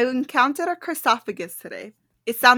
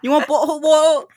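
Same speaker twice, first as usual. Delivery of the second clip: jokey imitation of a British accent; mock British accent; 3 semitones higher, faster than usual. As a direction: echo it in a faked British accent. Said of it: "I don't know how to do accents".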